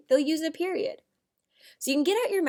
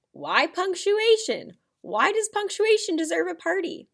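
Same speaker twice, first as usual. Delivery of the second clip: curious question; 7 semitones higher, 3 dB louder than usual. Pretend. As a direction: ask it like a puzzled kid. Teacher voicing students' question.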